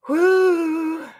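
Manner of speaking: loud, high "woo"; high whoop. Real, not acted. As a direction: let out one long high whoop. Whoops between card readings.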